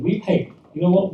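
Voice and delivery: ordinary delivery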